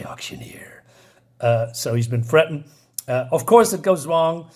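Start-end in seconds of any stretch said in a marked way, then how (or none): 0.0-0.8 hushed, breathy whisper on one word; whispered aside. Retold or imitated. Narrator voicing Conan asks the auctioneer, whispered. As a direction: slip the question out under your breath.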